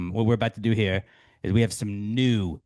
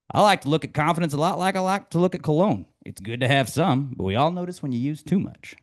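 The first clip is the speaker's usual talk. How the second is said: higher, folksy coachy delivery; Ted Lasso quote; 6 semitones higher than usual. Acted. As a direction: deliver it like homespun wisdom.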